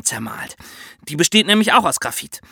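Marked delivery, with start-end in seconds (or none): none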